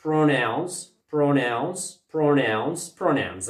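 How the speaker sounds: Pronouns said slowly and clearly for the class to repeat; drilled model word. Shown as a demonstration. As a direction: repeat Pronouns as a teacher's model, stressing PRO. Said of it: "يلا عاود".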